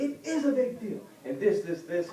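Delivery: high-pitched indignant protest; mock outburst from an upset driver; losing it. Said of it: "that's when she lost it".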